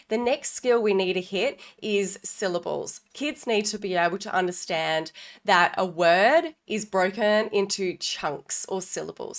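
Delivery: ordinary talk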